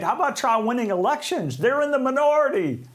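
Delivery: high-pitched, exasperated rhetorical jab; incredulous exasperation. Real, not acted. Host scoffing at the losing party's demand to negotiate, exasperated. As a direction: throw it out in rising disbelief.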